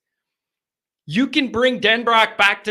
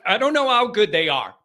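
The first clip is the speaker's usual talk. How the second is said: normal talking voice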